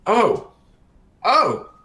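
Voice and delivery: high, loud dawning oh; the penny drops, out loud; surprised realization. Acted. Priest realizing the party is the help, surprised.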